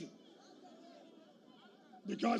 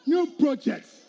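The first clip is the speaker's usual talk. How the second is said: "new projects" shouted louder each time, punching "new"; rally shout; 3 semitones higher, 6 dB louder, slower than usual. Real, not acted. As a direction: hammer the slogan to the crowd. Rally chant, repeated slogan.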